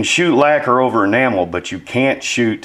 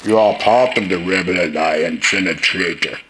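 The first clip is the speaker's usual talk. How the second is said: much lower robotic vocoder voice; too-deep vocoder; 5 semitones lower than usual. Shown as a demonstration. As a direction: Vader voice pushed too deep. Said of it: "I think that's a little too deep".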